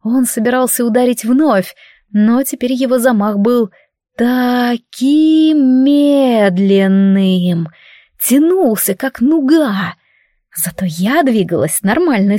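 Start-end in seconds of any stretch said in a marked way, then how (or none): 4.1-7.7 drawn out. A narrator's reading. Describing slowed-down swing.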